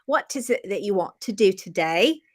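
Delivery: amateur imitation British accent; hammed-up British storybook voice. Shown as a demonstration. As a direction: put on a playful British accent. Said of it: "I try and do the British accent".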